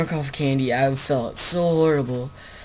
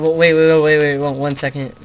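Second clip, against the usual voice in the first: urgent interruption; 7 dB louder, faster than usual. Real, not acted. Interrupting recording trouble.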